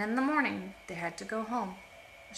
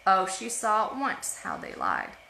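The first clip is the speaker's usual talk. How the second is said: ordinary delivery, nothing marked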